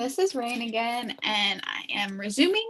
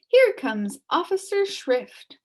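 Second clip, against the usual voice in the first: announcing onlooker; 8 semitones higher, 6 dB louder, slower than usual. Acted.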